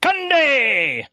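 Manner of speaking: drawn-out high holler of the name; mock announcer yell. Podcast co-host crowing a crew name in the credits, mock announcer yell. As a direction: belt it out high and let it fall.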